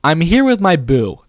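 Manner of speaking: slow, high, loud delivery; emphatic, drawn-out showy read; performed script line. Pretend. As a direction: sell it like an expressive video line.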